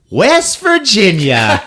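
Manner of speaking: high, slow, drawn-out triumph; mock confident announcement. Contestant spelling states and having fun, mock confident. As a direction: belt it out proudly, played for laughs. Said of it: "She's all confident now".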